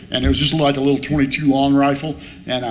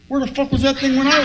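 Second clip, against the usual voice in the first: much higher, comic indignant squeak; mock outrage; 12 semitones higher, faster than usual. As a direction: play it as a mock-outraged question. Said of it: "I'm like".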